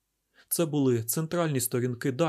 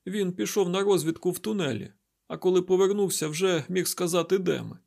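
higher-pitched, slightly louder character voice; injured woman recounting events; 7 semitones higher, 4 dB louder than usual. A narrator's reading. Derkhan tells Isaac about waking up, higher-pitched recounting. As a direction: voice a woman urgently telling what happened. Derkhan explains Lemuel's plan.

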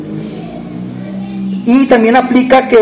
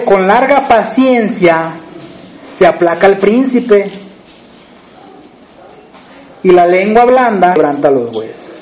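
scripture reading; 2 semitones lower, slower than usual. Acted. Reads scripture slowly.

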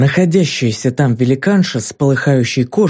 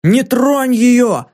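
much higher, very slow protective shout; cry out; 10 semitones higher, much slower than usual. A narrator's reading. Filin leaping between Ki and the monster, higher slow cry. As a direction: cry out to shield her, stretching each word. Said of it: "вскричал он".

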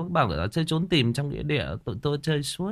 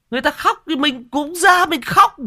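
mock wailing retelling; 9 dB louder than usual. Retelling crying along with mourners.

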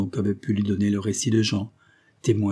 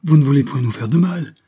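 higher, louder distressed plea; troubled; 5 semitones higher, 8 dB louder than usual. A narrator's reading. Peasant woman pleads behind the stranger, troubled and raised voice. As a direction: plead loudly, thoroughly rattled. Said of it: "la bonne femme toute troublée".

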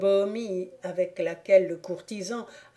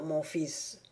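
ordinary delivery

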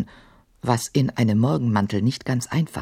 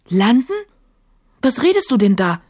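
alarmed, louder and higher; startled cry; 9 semitones higher, 5 dB louder than usual. A narrator's reading. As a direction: call out in fright. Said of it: "rief Emma erschrocken".

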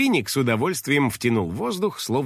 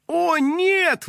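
very high wail of dismay; high-pitched despairing cry; dismayed exclamation. A narrator's reading. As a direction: cry out in horror, squeakily high. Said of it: "воскликнула Кристина".

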